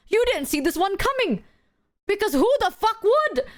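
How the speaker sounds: high-pitched stunned disbelief; mock shocked. Fencer facing a surprise bazooka, high stunned disbelief. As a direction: sound blindsided and outraged.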